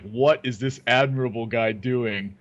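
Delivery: slow, drawn-out mock disbelief; mock self-critical commentary. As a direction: deliver it like an incredulous caster mocking himself. Mock commentary on own play.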